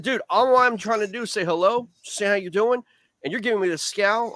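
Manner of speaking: lecturing a scowling boater, exasperated and indignant; retold rant. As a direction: replay an indignant chewing-out. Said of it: "chewing him out".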